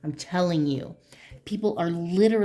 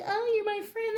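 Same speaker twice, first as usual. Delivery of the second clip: naive friend; 3 dB quieter than usual. Retold or imitated. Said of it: "And you're like".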